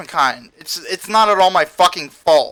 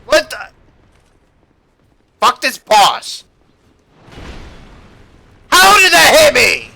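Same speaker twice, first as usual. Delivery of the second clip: raging gamer; 4 semitones higher, 4 dB louder than usual. Real, not acted. Raging at boss mid-fight.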